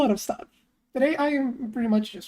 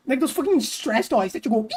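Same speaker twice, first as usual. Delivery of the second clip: usual speaking voice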